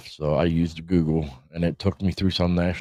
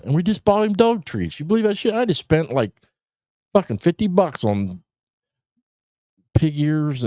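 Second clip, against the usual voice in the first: much higher, louder outraged disbelief; real exasperation at the dog; indignant rant; 11 semitones higher, 4 dB louder than usual. Real, not acted. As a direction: squeal in outrage at the wasted money. Ranting about dog chewing sheath.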